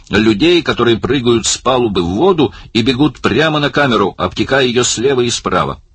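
high-pitched, driving instructions; calling out orders. A narrator's reading. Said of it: "крикнул он Далласу".

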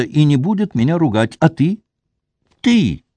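a touch of sobbing, rushing the words; hurried sobbing plea. A narrator's reading. Wife pours out her story to her husband, mildly tearful and rushed. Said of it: "торопясь и всхлипывая".

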